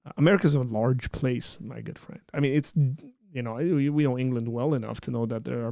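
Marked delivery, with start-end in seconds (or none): none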